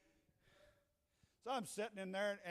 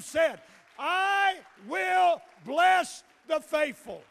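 full-force preaching; 6 semitones higher, 12 dB louder than usual. Real, not acted. Preacher declaring God's covenant blessing to believers, full-force preaching.

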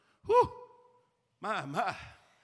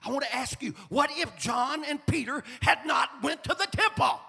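rising, louder sermon voice; preaching; 8 semitones higher, 10 dB louder than usual. Acted. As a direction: preach it out with fire. Preaching full force.

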